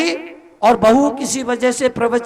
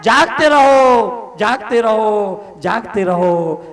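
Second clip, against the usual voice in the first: watchman's call fading away; 6 semitones lower, slower than usual. Acted. Monk warning sleepers to stay awake, slow fading watchman's cry. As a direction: call it out like a night watchman receding into the distance.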